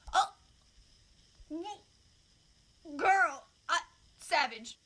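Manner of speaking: a loud burst of disbelief and delight; stunned delight. Real, not acted. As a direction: cry out in shocked admiration. Reacting to a wild story.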